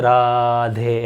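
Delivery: राधे said very slowly, last vowel held long; pluta vowel demo. Shown as a demonstration. Teacher showing students how राधे is read, stretched. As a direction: draw out राधे with a long final e. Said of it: "इसको ऐसे पढ़ा जाता है".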